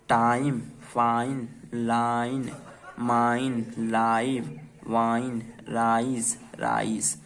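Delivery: टाइम, फाइन, लाइन each with a drawn-out "aa-ee"; one word at a time, stretching the glide, as in वाइन; long I sound. Shown as a demonstration. Teacher models the long-I list for learners, long I in टाइम, माइन, राइस. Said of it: "सबको आई के sound में ही पढ़ा है".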